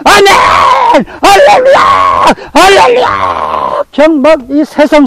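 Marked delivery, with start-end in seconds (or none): 0.0-3.8 drawn-out high shouts of amen and hallelujah, slow; 아멘 할렐루야를 길게 외치는 황홀한 외침; ecstatic shouting. Retold or imitated. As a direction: cry out like a worshipper overflowing with joy. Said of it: "요 때다 해가지고 이 양반".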